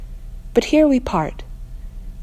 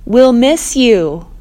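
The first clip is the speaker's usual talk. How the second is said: loud and slow, higher, called out; raised voice over rain; 5 semitones higher, 7 dB louder, much slower than usual. Acted. Bella calling over the rain, slow and loud. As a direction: call it across the noise, drawn out. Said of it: "Bella raises her voice to be heard".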